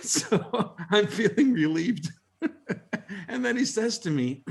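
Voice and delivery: laughing through the words. Real, not acted. Telling colleagues the punchline, laughing. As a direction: chuckle as you tell it.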